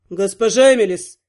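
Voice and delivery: low-voiced male indignation; stern, deep-pitched objection; indignant. A narrator's reading. Prince stops the heroine heading for the bedroom, indignant. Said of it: "была остановлена возмущённым".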